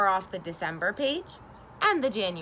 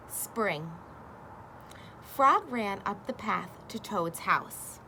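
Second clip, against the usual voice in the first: usual speaking voice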